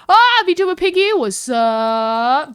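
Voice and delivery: high, loud greeting sliding down on a long "up"; mock-excited, drawn-out hey; excited shout-out. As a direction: holler hello like you spotted a friend. Imagined greeting on spotting a car.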